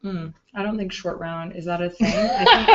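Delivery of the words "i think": laughing. Real, not acted. Laughing at own joke.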